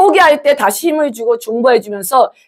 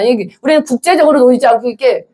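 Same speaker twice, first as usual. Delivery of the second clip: normal talking voice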